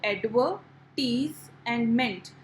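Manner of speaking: slow, with "adver", "tease" and "ment" set apart; separated syllables. Shown as a demonstration. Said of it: "these are the three parts". ("adver", "tease", "ment" अलग-अलग, धीरे बोलें)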